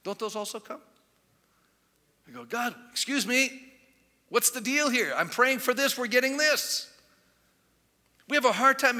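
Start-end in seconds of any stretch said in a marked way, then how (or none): 2.4-6.9 mock complaint. Acted complaint to God.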